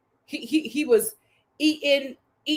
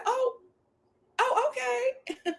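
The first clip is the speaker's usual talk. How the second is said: high, soft, slow "oh"; small, high, amused surprise; taken-aback reaction; 9 semitones higher, 6 dB quieter, slower than usual. Retold or imitated. Host recalling her reaction to the little peck, soft taken-aback oh.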